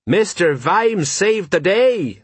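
high, drawn-out proud announcement; excited sergeant. A narrator's reading. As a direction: proclaim it excitedly, stretching the words. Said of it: "said Sergeant Colon excitedly".

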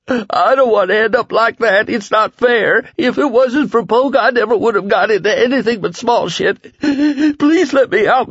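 high, loud, desperate begging; sobbing plea. A narrator's reading. Starving Lloyd sobbing to Flagg to let him out. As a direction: beg through tears to be let out. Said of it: "Lloyd sobbed".

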